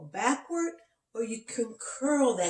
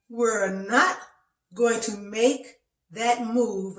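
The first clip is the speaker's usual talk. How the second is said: slow, emphatic refusal, word by word; protesting body's voice; 2 semitones higher, 5 dB louder, slower than usual. Retold or imitated. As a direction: spell out the refusal slowly and firmly. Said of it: "My body was talking to me".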